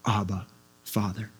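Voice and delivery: low, soft, reverent address; understated, intimate call; hushed cry to God. Retold or imitated. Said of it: "you and I could cry out".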